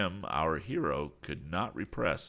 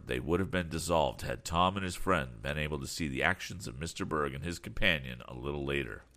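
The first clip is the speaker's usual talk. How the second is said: ordinary delivery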